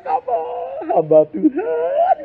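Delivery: high, slow, whining plea to papa; pleading call. Retold or imitated. Child begging papa as servant of God, a high whine. Preacher mimics plea to father.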